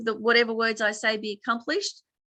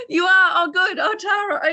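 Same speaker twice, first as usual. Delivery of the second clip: high, loud, delighted reaction; pleasantly surprised; 9 semitones higher, 7 dB louder than usual. Real, not acted. Teacher hears students already do the practice, pleasantly surprised. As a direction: exclaim with real delighted relief.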